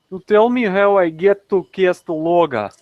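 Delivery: put-on slow, thick Russian accent; mock Russian accent. Asking directions to Calistoga, mock Russian accent. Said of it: "I try to do Russian accents".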